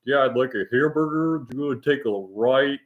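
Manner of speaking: lightly voiced American GPS impression; a hint of a dopey American accent; mock American accent. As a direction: play a foreigner's idea of an American GPS. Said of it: "use American accents on their GPS".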